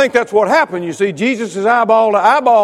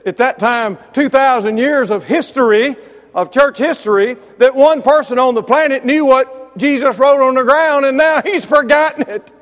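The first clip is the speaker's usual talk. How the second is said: raised, higher voice in mock dismay; higher and louder mock lament; Hardiman's retort; 7 semitones higher, 5 dB louder than usual. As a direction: lament it loudly, tongue in cheek. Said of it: "Brother Hardiman replied by saying".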